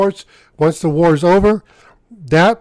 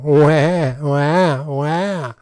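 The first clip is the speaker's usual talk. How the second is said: mock crying; much slower than usual. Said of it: "Who's the crybaby now?".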